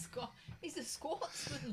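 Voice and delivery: high, put-on Scottish accent; mock Scottish voice. Co-host naming a man's nationality, in mock Scottish voice. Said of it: "have to do the voice".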